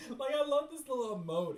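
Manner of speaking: high, loud and fast, squealing with delight; gushing. Real, not acted. Fangirling over a cute emote, excited squeal. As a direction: gush over something adorable.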